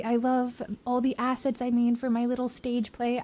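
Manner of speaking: ordinary delivery, nothing marked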